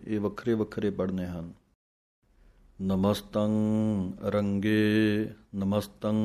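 slow, measured chanting of verse; measured devotional recitation; scripture recitation. Acted. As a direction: recite each line deliberately, as in a santhya class. Recites Jaap Sahib verses.

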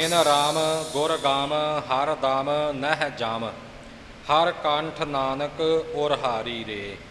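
slow, even chant of the rhyming words; unhurried recitation with even rhymes; model recitation. Shown as a demonstration. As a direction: recite it slowly, with no syllable made heavy. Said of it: "ਇਵੇਂ ਹੀ ਇਹਨਾਂ ਦਾ ਉਚਾਰਨ ਕਰਨਾ ਹੈ".

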